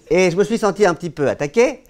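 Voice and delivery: usual speaking voice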